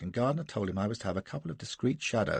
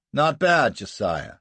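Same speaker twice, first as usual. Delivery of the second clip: louder sardonic praise; mocking greeting; 2 semitones higher, 7 dB louder, slower than usual. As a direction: needle him with the first words. [name] mocking Jasser.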